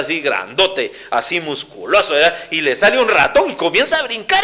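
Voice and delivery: usual speaking voice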